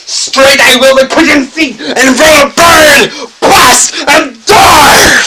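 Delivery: high, loud declaiming shout; full shout. Acted. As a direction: hurl the line out at full volume. Dramatic outburst in poem.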